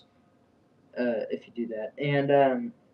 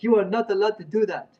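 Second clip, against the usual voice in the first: high-pitched mock Indian-accented scolding; rough Indian accent, pitched well up; imitated Indian accent; 8 semitones higher, 3 dB louder than usual. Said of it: "hard Indian or something".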